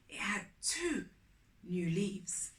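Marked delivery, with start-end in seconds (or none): none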